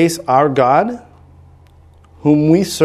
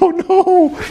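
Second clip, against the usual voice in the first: dismayed oh no. Retold or imitated. Realizes he hears nothing.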